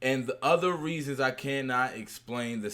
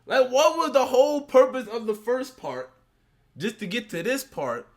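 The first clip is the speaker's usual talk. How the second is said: much higher, louder disbelief; baffled question; 7 semitones higher, 4 dB louder than usual. Real, not acted. Host asking the co-host, baffled and incredulous. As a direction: demand an answer, astonished.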